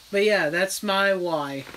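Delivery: usual speaking voice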